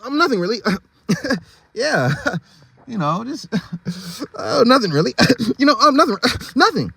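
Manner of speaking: high, giggly nervous dodge; nervous laughing. Acted. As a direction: giggle nervously to hide the secret. Nervous zebra caught out, laughing.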